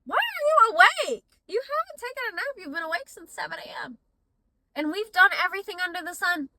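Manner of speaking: put-on high, exasperated question to a toddler who won't sleep; mock disbelief at the wide-awake kid; exasperated mom. Mother scolding her toddler at bedtime, exasperated. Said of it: "I'm like".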